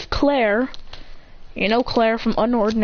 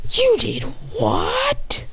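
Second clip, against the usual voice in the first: high, drawn-out incredulous question; acted disbelief; 13 semitones higher, much slower than usual. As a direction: squeak it slowly in mock shock.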